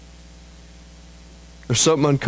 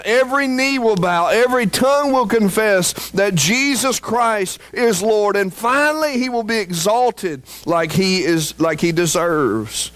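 much higher, ringing preacher's climax; preaching at full force; 9 semitones higher than usual. Acted. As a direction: lift it up and proclaim it to the rafters. Climax of sermon.

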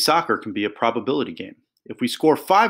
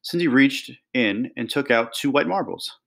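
usual speaking voice

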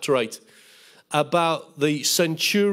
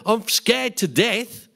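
slow, drawn-out mock fearful moan of I'm scared to death; mock scared whine; 3 semitones higher, slower than usual. Preacher mocking a fearful churchgoer's slow frightened moan. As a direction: send up a timid believer's fretful confession. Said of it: "don't go around saying".

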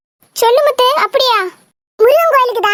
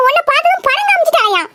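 normal talking voice

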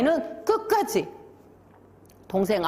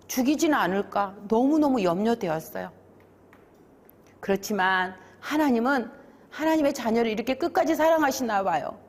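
ordinary delivery, nothing marked

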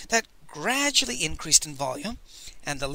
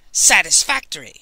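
loud, gruff grunt from a huge woman; grunted verdict; 9 dB louder than usual. A narrator's reading. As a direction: bark it out like inspecting cattle. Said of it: "she grunted".

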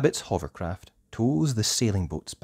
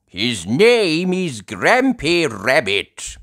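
slow, loud, raised character voice; Grandpa Pig; 8 semitones higher, 8 dB louder, slower than usual. Acted. Grandpa Pig introduces his friend, slow loud voice. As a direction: introduce him slowly and firmly. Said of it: "replies Grandpa Pig".

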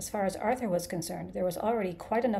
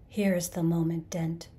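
ordinary delivery

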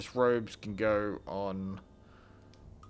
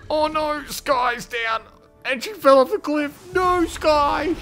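very high, loud wail of dismay; dismayed shout; 11 dB louder, slower than usual. Real, not acted. Player watching Sky fall off the cliff, loud dismay. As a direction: cry out for a fallen companion. Party member falls off cliff.

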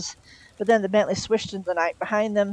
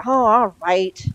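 grudging, drawn-out giving in, louder; wretched concession; 3 semitones higher, 6 dB louder, much slower than usual. A narrator's reading. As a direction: give in miserably, stretching the words slowly. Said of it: "said Crowley wretchedly".

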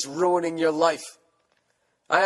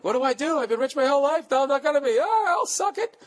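much higher, whiny voice of a spoiled model; mock panicking rich girl; 12 semitones higher than usual. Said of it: "She's freaking out".

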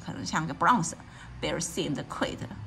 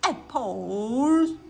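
booming bear; 3 semitones higher than usual. A narrator's reading. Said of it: "boomed the bear".

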